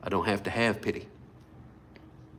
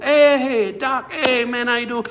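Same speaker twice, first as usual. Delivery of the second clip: cheery greeting; 12 semitones higher, 6 dB louder than usual. Acted. Acted greeting to a church friend.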